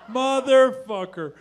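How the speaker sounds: a dude realizing his tattoo mistake; slow furious curse. Retold or imitated. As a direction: drag out the rage.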